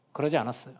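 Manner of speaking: ordinary talk, nothing marked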